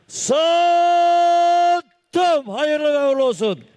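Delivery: high closing call of the sale; auctioneer. Real, not acted. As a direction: bang the gavel and bless it.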